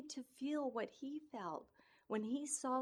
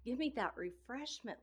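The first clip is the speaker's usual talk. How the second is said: ordinary talk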